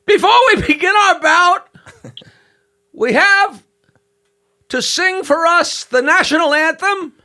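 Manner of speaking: high, loud announcer voice; ring announcer. Retold or imitated. Comic voicing the announcer introducing [name], high and loud. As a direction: announce it to the whole arena. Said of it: "the announcer comes out and says".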